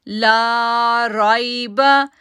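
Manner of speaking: "ला राइबा" slow with "ला" stretched; long laa. Shown as a demonstration. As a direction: hold the "ला" long. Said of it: "ला को खींचेंगे". ("ला" को लंबा खींचो)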